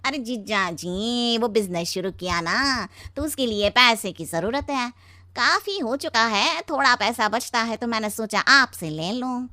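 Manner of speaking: chuckle, then very slow, stretched greeting; oily brother-in-law. Acted. Wife's brother greeting his brother-in-law, drawling ingratiatingly. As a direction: drag out every word in a put-on voice.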